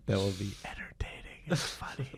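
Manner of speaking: whispered aside to his partner; hushed coaching so the mic barely hears. Real, not acted. Host whispering tips to his partner before the show, whispering.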